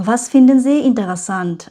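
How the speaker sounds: very slow, careful textbook read; slow German reading. Shown as a demonstration. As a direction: read each word out slowly for learners. Reads German question slowly. (ধীরে ধীরে German পড়া)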